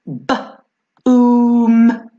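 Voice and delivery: "b-" then a loud, stretched "oom"; sounding out. Shown as a demonstration. Teacher sounding out "boom" for the class, loud on oom. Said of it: "sounding out boom".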